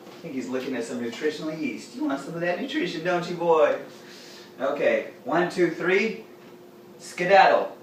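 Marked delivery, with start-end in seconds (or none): 1.9-3.8 pet talk. Real, not acted. Talking to his cat. 5.2-7.8 slow count then skedaddle. Shown as a demonstration. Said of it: "My skedaddle was too quick".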